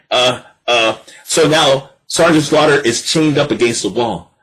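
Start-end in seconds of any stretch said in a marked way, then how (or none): none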